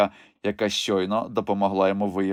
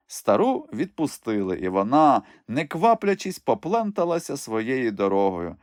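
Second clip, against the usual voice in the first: ordinary delivery, nothing marked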